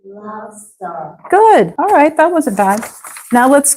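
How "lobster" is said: "Lobster" repeated much softer, under the breath; soft echo. Shown as a demonstration. Student softly echoes the tutor's "Lobster", near silent. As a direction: murmur "Lobster" back quietly. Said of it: "echo drill".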